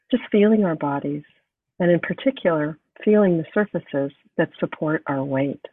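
measured phrases with long, restful pauses; guided meditation. Acted. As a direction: guide them gently into the breath, unhurried. Leads breathing meditation and reading.

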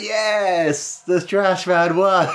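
high, stretched mock cheer; imitated fans' triumphant cry; cheering crowd. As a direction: cheer like a crowd of fans at a win. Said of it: "You see the crowd all going like".

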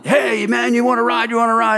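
high-pitched mock calls of eager drivers; imitating yelling drivers. Airport drivers hustling a lone traveller, mock yelling. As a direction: call out like a crowd of pushy taxi touts. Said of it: "they're all yelling".

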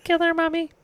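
put-on high creepy kid voice; eerie small child begging mother; child's voice. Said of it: "she says in a child's voice".

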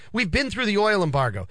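ordinary talk, nothing marked

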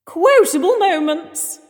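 high, loud, drawn-out announcement; segment announcer shout. Pretend. Co-host announcing the next segment, big playful shout. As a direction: belt out the segment title slowly.

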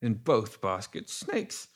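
confused, drawn-out, high-pitched babble; dazed mumbling. A narrator's reading. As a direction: ramble slowly in a muddled daze. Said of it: "he was mumbling".

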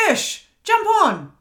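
high, loud, drawn-out summons; coach calling out. Retold or imitated. Coach calling her onto the live call, high loud shout. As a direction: call someone up with a big shout. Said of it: "'cause he's like".

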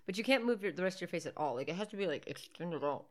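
ordinary delivery

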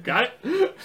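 high, light "got it?"; mock question voice. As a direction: ask it like a grinning mime. Miming a gesture, high voice.

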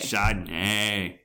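drawn-out California surfer drawl; slow Californian take, stretching the last syllable; California surfer accent. Acted. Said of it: "While surfing and doing other California things".

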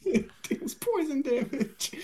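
laughing through the words. Real, not acted. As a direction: giggle it out. Laughing repeat.

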